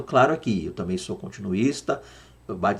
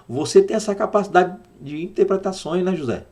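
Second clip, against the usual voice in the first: Pharaoh's voice; 7 semitones higher than usual. Acted. Pharaoh voiced in the retold story.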